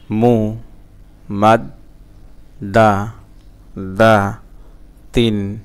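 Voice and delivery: "মু, মাদ, দা, দা, তিন" as isolated syllables with gaps; broken syllable-by-syllable. Shown as a demonstration. Teacher breaking a word for learners, one syllable at a time. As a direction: sound out "মু, মাদ, দা, দা, তিন" piece by piece. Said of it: "ভাঙা ভাঙা".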